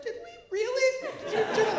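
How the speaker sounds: a tiny sceptical voice in the head; squeaky doubting inner voice, laughing; small doubting inner voice. Pretend.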